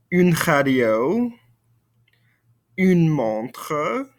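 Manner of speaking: slow, careful French for the class to repeat; slow French vocabulary drill; French model phrases. Shown as a demonstration. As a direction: say each word slowly so students can copy. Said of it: "répétez-moi".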